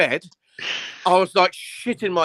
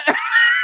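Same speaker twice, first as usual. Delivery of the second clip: breathy laugh; 10 semitones higher, 5 dB louder than usual. Real, not acted. Laughing at awkward encounter.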